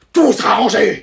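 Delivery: put-on harsh, drawn-out yell at the child; mother yelling. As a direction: bellow it as an order to your kid. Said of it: "moi tu me cries dessus". (mère qui hurle un ordre)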